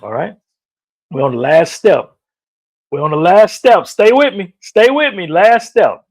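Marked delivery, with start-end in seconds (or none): 1.1-2.2 loud, urging call to keep going; rally them like a coach; loud push. Real, not acted. Coach rallying viewers on the last step, loud. 2.9-3.9 louder rally. Real, not acted. Pushing viewers through the final step. 3.9-6.0 high, loud urging cry; rallying shout. Real, not acted. Motivational host urging viewers onward, shouting high.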